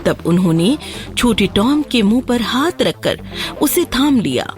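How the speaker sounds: ordinary delivery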